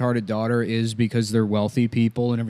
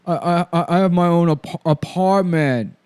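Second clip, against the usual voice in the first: higher, stuttering movie character; Giovanni Ribisi; 5 semitones higher, slower than usual. Acted. Boyfriend explaining being Italian, hammed-up stutter. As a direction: get stuck on words, then drag them out. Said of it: "Giovanni Ribisi, who's just, like, Italian".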